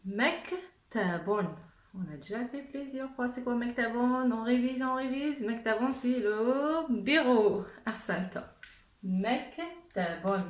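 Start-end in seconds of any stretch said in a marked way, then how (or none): 0.1-1.5 Mektabon stretched out syllable by syllable; slow model word. Shown as a demonstration. Teacher leads the class reading very slowly. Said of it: "Allez, ensemble". 9.0-10.5 Mektabon stretched out syllable by syllable; slow model word. Shown as a demonstration. Teacher leads the class reading very slowly. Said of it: "Allez, ensemble".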